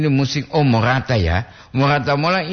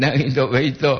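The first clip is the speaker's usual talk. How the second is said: ordinary talk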